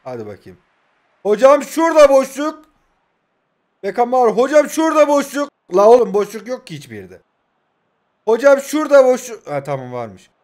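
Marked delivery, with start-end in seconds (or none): 1.2-9.5 calling out. Real, not acted. Calling for a pass repeatedly.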